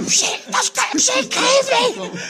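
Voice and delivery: high, excited yelp of disbelief; mock shout. Brother phoning from Australia, in a high excited shout. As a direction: shout it way up high like a shocked mate. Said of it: "He's phoned me saying".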